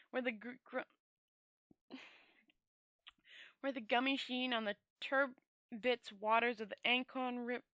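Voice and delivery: ordinary delivery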